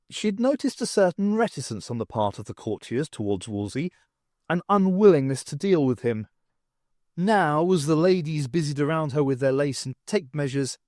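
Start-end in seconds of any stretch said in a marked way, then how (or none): none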